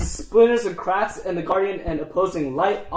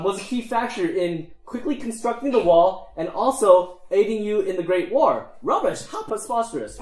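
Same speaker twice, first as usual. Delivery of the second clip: high-pitched affronted gnome; scandalized gnome, leaping up in pitch; indignant gnomes; 4 semitones higher than usual. Acted. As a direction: lecture them in high, outraged gnome voice.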